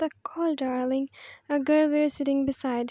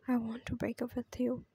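softer, barely voiced breakup line; cold whisper; 6 dB quieter than usual. She repeats the breakup.

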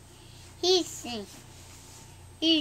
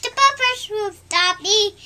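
loud, high confident cry; 8 semitones higher, 9 dB louder than usual. Acted. Thomas rushing toward the house, loud confident cry. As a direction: call it out sure of yourself. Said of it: "Thomas said".

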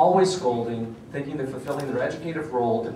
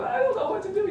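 much higher, wailing mock sob; imitated crying wail. Mother crying at the booth, a higher mock wail. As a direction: play her sobbing helplessly, pitched way up. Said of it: "she's screaming and crying".

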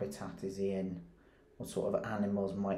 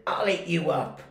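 higher, louder defiant retort; cheeky shout back; 8 semitones higher, 7 dB louder than usual. A narrator's reading. Max answering his mother back, defiant shout. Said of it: "and Max said".

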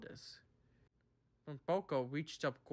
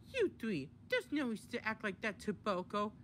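high-pitched stern scolding; Daisy, stern; 7 semitones higher than usual. A narrator's reading. Daisy tells off the three engines, sternly. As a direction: scold them in a high character voice. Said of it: "leaving a stern Daisy behind".

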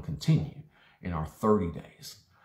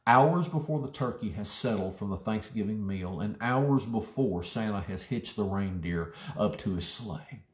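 normal talking voice

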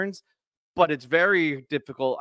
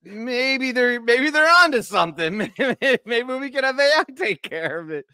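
mock wishful musing; 9 semitones higher than usual. Joking about using AI himself.

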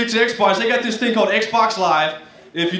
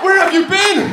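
high, raised exclamation; mock amazement; 10 semitones higher, 4 dB louder than usual. Man smitten by an ordinary girl, high mock amazement. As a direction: cry out as if utterly smitten, hammed-up. Acts out the easily impressed man.

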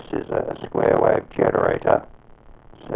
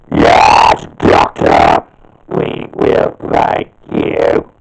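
loud, barking robotic battle cries; louder ring-modulated commands, the last line slow; robot villain voice; 7 dB louder, slower than usual. Shown as a demonstration. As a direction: shout orders like a mechanical alien war machine. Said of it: "practice demonstration to see how it will sound".

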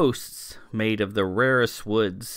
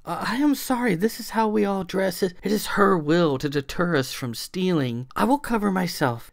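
flustered servant; 8 semitones higher than usual. A narrator's reading. Servant apologizing, embarrassed.

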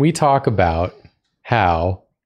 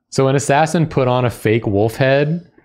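ordinary talk, nothing marked

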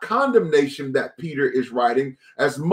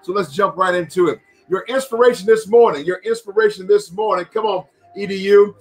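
hype preacher opening; 4 semitones higher than usual. Acted. Opening hype greeting over music.

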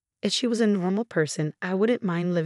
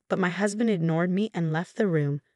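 ordinary delivery, nothing marked

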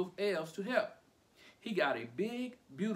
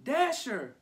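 high, loud name call; child calling his team; 8 semitones higher, 6 dB louder than usual. A narrator's reading. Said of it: "He's calling them by name".

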